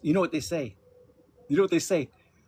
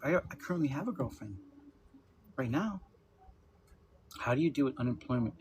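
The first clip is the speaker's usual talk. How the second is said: ordinary delivery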